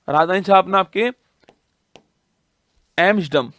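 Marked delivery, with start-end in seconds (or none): 3.0-3.5 एम्स्टर्डम said with the T silent. Shown as a demonstration. Teacher showing students the word, एम्स्टर्डम with a silent T. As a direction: drop the T sound in एम्स्टर्डम. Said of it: "यहाँ पर टी silent है ना".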